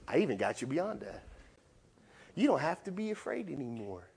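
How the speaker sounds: usual speaking voice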